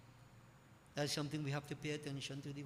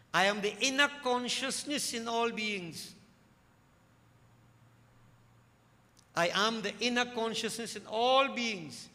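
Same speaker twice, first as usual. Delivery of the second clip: divine voice of Mayuresh; 5 semitones higher, 7 dB louder than usual. Acted. Mayuresh answering Kartikai, preached loud and high. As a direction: proclaim "I am the inner consciousness in all beings" high, loud and slow. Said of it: "Mayuresh replied".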